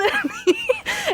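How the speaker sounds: imitated helpless laughter; high wheezing laugh. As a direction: burst out laughing. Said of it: "falling out laughing".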